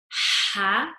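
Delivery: ordinary talk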